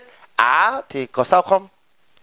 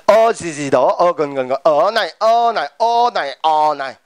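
ờ repeated on rising and falling pitches; varying tones; 2 semitones lower than usual. Shown as a demonstration. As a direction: say each "ờ này" on a different tone. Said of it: "tất cả đều giống nhau hết".

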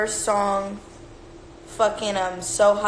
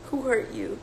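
usual speaking voice

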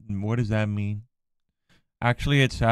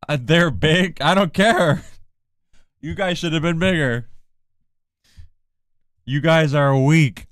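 mock taunting; 5 semitones higher, 6 dB louder than usual. Mock arguing with Australians.

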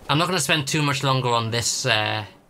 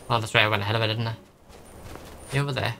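ordinary talk

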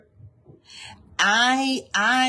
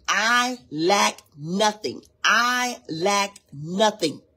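slow affirmation; 2 semitones higher, much slower than usual. Shown as a demonstration. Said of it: "Say it".

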